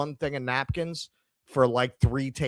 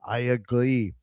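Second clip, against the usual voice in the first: imitated Israeli accent at an everyday pitch. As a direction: mimic an Israeli man's English. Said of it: "that's when I realized he was Israeli".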